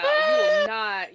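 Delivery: high, loud, slow drawn-out order; hammed-up strict mother; mock stern command. Friend jokingly forbidding the song, high and drawn out.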